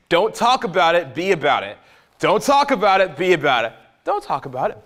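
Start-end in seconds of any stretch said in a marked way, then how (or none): 4.0-4.8 softer, held back with a rising end; hushed setup. Real, not acted. Coach drilling a motto into the guys, hushed setup. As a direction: hold it back before the punchline. Repeated motto, soft then punched.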